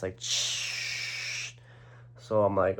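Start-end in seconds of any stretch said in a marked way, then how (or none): 0.2-1.5 imitated gushing tap, long shh; running water noise. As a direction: hiss like water on full blast. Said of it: "It's on full blast".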